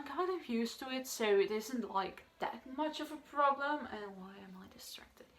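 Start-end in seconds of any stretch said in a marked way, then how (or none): 3.9-5.3 very soft, whispered self-question; whispered aside. Real, not acted. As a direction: whisper it to yourself off-hand. Muttered aside to herself while filming.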